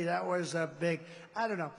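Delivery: normal talking voice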